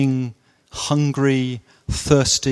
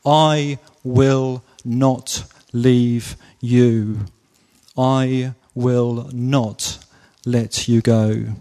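very slow, weighted on I and not; slow emphatic promise; 3 semitones lower, much slower than usual. Shown as a demonstration. Preacher voicing Jesus's promise to the church, slow and emphatic. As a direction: spell it out word by word like a vow. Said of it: "gives us a strong, emphatic promise".

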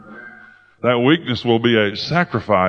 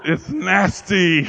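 high, drawn-out disgusted whine with laughter around it; grossed-out protest; 6 semitones higher, much slower than usual. Retold or imitated. Preacher retells objecting to God about the nose, grossed out. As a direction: stretch it out in comic revulsion. Recoils from God's odd instruction.

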